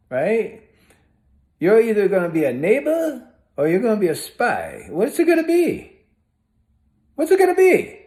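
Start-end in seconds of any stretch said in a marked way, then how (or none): 4.9-8.0 high-pitched, insistent demand; retold challenge, voice pitched way up; confrontational challenge. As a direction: press them hard for an answer. Replays confronting the suspected spy.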